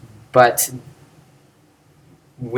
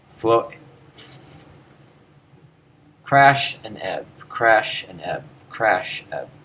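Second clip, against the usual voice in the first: chanted crash and ebb like waves; rhythmic wave chant; slower than usual. Shown as a demonstration. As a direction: let each crash land and ebb away. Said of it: "like a wave that ripples to the shore".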